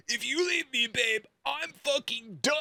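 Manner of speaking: high, hammed-up threat from a clingy boyfriend; mock angry boyfriend. Mocking a clingy boyfriend's breakup threat, hammed-up high voice. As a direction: squeal the ultimatum like a possessive guy. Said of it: "And then Kyle's like".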